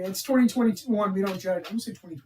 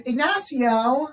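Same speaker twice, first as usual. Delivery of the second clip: drawn-out, raised imitated name call; wholehearted greeting; 5 semitones higher, 4 dB louder, much slower than usual. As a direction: stretch the name, lifting at the end. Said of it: "meant it, like, so wholeheartedly".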